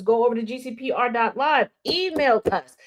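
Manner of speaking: ordinary delivery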